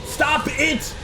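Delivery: high yell at the enemy; real outburst, voice jumping way up; frustrated shout. Real, not acted. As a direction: shout it at the game in exasperation. Player yells at game enemies.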